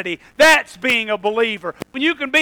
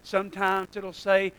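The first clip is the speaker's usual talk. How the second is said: much softer and lower, calm; slow and calm; 6 semitones lower, 14 dB quieter than usual. Shown as a demonstration. Preacher calmly warning the congregation about sin. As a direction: lower it right down and go gently. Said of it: "real slow and calm".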